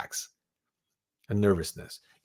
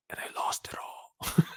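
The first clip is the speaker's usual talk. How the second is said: whispered loss; 8 dB quieter than usual. Real, not acted. Punchline of losing everything.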